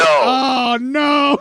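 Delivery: very high, loud wail of dismay; dismayed outcry. Real, not acted. Host hears the dreaded caller, loud high cry of dismay. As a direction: cry out in big, drawn dread.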